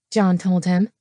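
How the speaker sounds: plain reading voice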